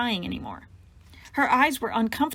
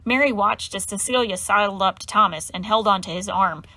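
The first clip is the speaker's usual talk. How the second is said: usual speaking voice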